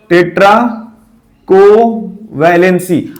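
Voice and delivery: टेट्राकोवैलेंसी stretched out slowly, syllable by syllable; slow syllable drill. Shown as a demonstration. Teacher to students, drilling the new term slowly. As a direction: spell out टेट्राकोवैलेंसी slowly for the class to repeat. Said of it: "क्या बोला जाता है?".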